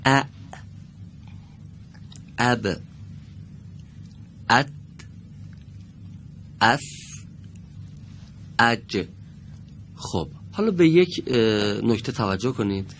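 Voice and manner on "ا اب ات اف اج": each letter said alone with a long pause after it, the silent sound joined to the vowel before it; teacher modelling letters; model letter reading. Shown as a demonstration. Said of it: "خب دقت کنید".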